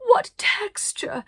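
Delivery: very high, slow rapturous cry. Acted. Arcadia admires the children, very high slow cry. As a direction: swoon over it. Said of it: "saying things like".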